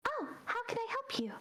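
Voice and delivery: high polite owl voice. Acted. Librarian owl greeting the players, high and polite. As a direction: lift way up into a helpful little owl. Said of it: "they just float down and they go".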